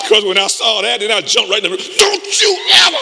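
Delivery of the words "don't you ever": high, loud laughing yell; triumphant shout. Retold or imitated. Jumping on the fallen bully.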